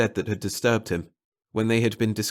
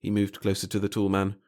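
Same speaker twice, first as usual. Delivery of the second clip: ordinary delivery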